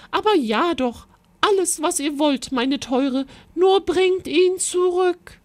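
a queen babbling yes in a high voice; flustered queen. Acted. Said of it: "rief die Königin".

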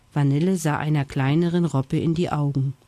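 plain reading voice

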